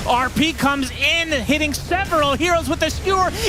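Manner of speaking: fast, rising hype commentary; play-by-play. Acted. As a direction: call the teamfight as it happens. Live esports play-by-play.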